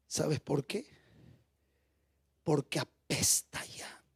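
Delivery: slow whisper, ashamed; whispered confession. Acted. Martha telling Jesus her brother already stinks, slow whisper. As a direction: breathe it out like a shameful secret.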